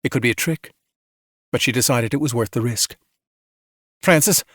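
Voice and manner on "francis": raised, firm command; giving an order. A narrator's reading. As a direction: order it done at once. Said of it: "she ordered".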